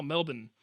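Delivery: "Melbourne" as MIL-bin; local pronunciation. Shown as a demonstration. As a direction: swallow the r and o in "Melbourne". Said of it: "The U got converted into an I somehow".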